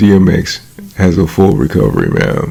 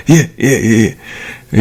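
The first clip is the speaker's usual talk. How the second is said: higher, fast slick yeah-yeahs; slick New York talk; 3 semitones higher, much slower than usual. Retold or imitated. Stranger talking slick while he watches boxing, higher mock voice. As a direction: brush it off slick like a New Yorker. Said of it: "that New York slick tongue shit going".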